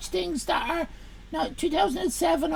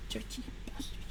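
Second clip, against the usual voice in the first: muttered with no voice; whispered insult; 8 semitones lower, 17 dB quieter, faster than usual. Real, not acted. Streamer insulting a viewer under his breath, whispered.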